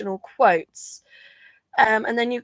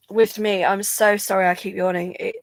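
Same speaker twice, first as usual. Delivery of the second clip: normal talking voice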